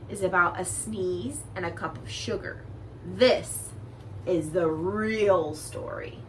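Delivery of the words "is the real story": real story drawn out slowly, weight on real; slow emphatic reveal. A narrator's reading. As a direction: lay it down slowly like a confession.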